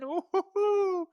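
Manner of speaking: high, slow excited hooting. Real, not acted. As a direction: hoot with delight. Excited about a quarterback pick.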